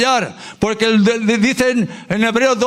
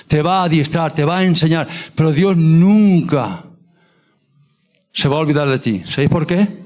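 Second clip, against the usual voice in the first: normal talking voice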